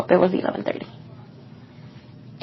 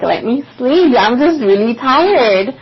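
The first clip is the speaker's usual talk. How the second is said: higher, louder whine to be left to sleep; mock whiny plea; 4 semitones higher, 4 dB louder than usual. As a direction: beg to sleep like a tired kid. Retold pleading to be left alone.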